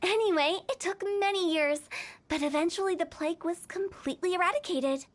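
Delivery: ordinary delivery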